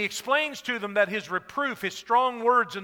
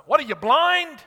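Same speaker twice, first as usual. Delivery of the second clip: rude retort; 5 semitones higher, 7 dB louder than usual. Retold or imitated. Acted rude passerby.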